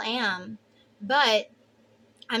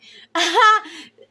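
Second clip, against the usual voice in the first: loud laugh; 7 semitones higher, 11 dB louder than usual. Real, not acted. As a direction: burst of high, loud laughter. Laughs at her own joke.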